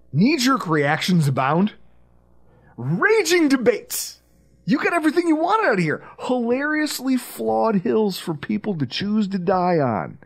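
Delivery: rising, showy announcer voice; dramatic hype. Acted. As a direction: sell it like a movie trailer.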